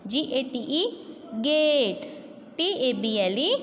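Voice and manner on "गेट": गेट with the "ay" held long; गेट, long drawn-out A; long vowel. Shown as a demonstration. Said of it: "ए का sound थोड़ा लंबा होता है".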